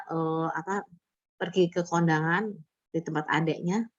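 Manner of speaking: ordinary talk, nothing marked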